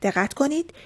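normal talking voice

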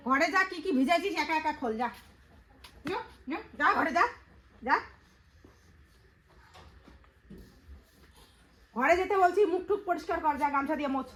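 high-pitched, impatient commands; real motherly telling-off; scolding orders. Real, not acted. Mother sends wet child indoors.

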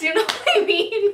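ordinary delivery, nothing marked

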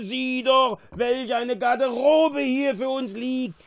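high, loud, delighted exclamation; excited cry. A narrator's reading. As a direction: call out in raptures at a find. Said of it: "rief er begeistert".

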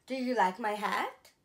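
ordinary delivery

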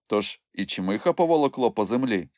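plain reading voice

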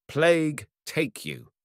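drawn-out contemptuous curse; slow curse. Acted. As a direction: spit the curse slowly. Hunter curses the forester.

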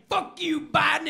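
loud, high defiant yell; shout at the sky. Acted. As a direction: yell up at the government birds. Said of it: "and I say".

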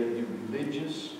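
normal talking voice

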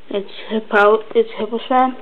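ordinary talk

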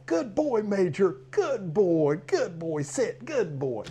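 put-on high, gushing good boy; big love praise. Shown as a demonstration. Trainer gushing praise at his dog, high and bright. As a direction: pour praise over the dog. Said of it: "I just get big love".

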